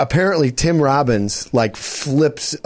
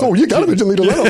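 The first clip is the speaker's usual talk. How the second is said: fast, laughing goofy voice; Kramer; 8 semitones higher, much faster than usual. Retold or imitated. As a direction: rattle it off like a wacky neighbor. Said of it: "And then Kramer goes".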